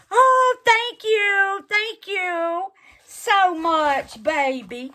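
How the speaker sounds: high and slow, overjoyed; gushing thanks. Real, not acted. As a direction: sing out your thanks. Thanking the sender.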